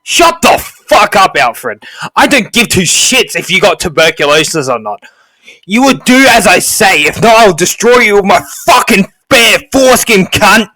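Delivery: high, very loud enraged tirade; furious shouting. Acted. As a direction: scream abuse at an underling. Sergeant screams threats at servant.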